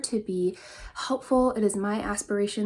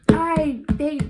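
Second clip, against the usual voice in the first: forceful yell; 7 semitones higher, 8 dB louder than usual. Shown as a demonstration. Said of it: "it would be like".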